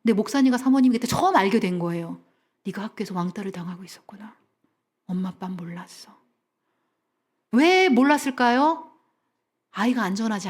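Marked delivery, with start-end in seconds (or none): 2.7-6.2 put-on hushed, gentle confession to a child; tender whisper. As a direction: whisper it softly like a sorry parent. Parents comfort bullied child.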